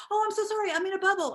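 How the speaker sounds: high, bright flustered apology; apologizing to a stranger in the elevator, high and flustered. Retold or imitated. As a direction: gush the apology, backing off. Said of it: "I go".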